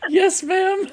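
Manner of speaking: high, laughing yes; laughing reply. Real, not acted. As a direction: answer obediently through a laugh. Laughing reply to teasing question.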